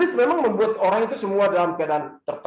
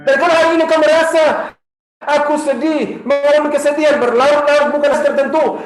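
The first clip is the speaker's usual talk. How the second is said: louder, higher, preaching to the crowd; preaching at full force; 5 semitones higher, 7 dB louder than usual. Acted. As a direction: proclaim it like a promise from the pulpit. Sustained preaching climax.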